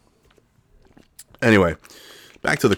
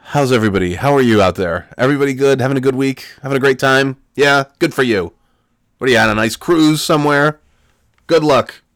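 sarcastic host cheer; 5 semitones higher, 6 dB louder than usual. Acted. Sarcastic host greeting to listeners.

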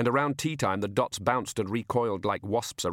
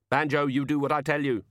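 mild scream, higher; understated narrated scream; 6 semitones higher, 3 dB louder than usual. A narrator's reading. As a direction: order with a touch of hysteria. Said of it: "Tea Time screamed".

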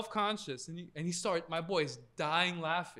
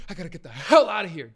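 sudden loud burst on "hell"; imitated urgent outburst; 14 dB louder, faster than usual. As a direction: act out blurting it to nobody in desperation. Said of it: "just like that".

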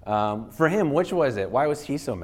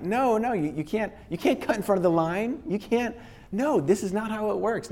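high, mock-outraged scolding of a line cutter; indignant protest; 11 semitones higher than usual. Speaker acting out scolding someone who cut in line, mock-outraged and higher. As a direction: play it as a flustered, pitched-up complaint.

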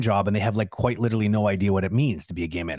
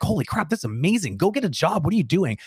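higher-pitched imitation of impressed fans; mock-amazed urging, pitched up; amazed praise; 7 semitones higher than usual. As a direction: gush like an impressed commenter. Acting out impressed commenters' praise.

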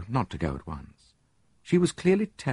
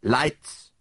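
brisk, higher-pitched command; brisk order; 6 semitones higher than usual. A narrator's reading. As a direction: call it out briskly. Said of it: "said Poirot briskly".

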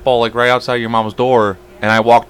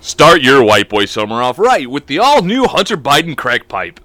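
mock ad read; 4 semitones higher than usual.

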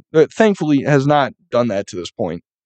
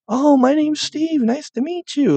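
high, sugary mock greeting; mocking forced salesman niceness; fake-nice salesman; 13 semitones higher than usual. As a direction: ham up the polite salesman.

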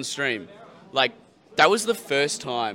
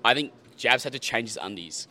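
normal talking voice

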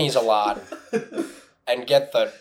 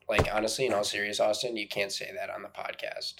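hushed scolding; 5 semitones lower, 10 dB quieter than usual. Acted. Scolding quietly aside.

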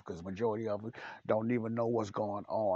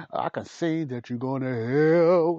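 angry shout; 6 semitones higher, 12 dB louder, slower than usual. Shown as a demonstration. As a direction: shout it, much louder and higher, slowly. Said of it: "Or I can say it in an angry way".

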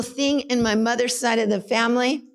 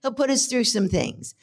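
usual speaking voice

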